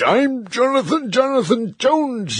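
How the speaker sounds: high indignant protest; furious boy. A narrator's reading. Caged boy protests his name, high and indignant. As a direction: shout your own name, high and outraged. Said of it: "got in a furious rage".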